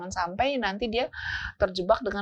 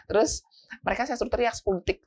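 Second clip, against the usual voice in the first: normal talking voice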